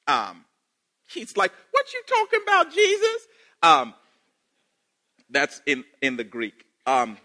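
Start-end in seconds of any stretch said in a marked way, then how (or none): 1.7-3.3 high, fast, sassy comeback. Retold or imitated. Samaritan woman sassing Jesus at the well, high and fast. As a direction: toss it back with mock sass. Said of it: "She is super sassy".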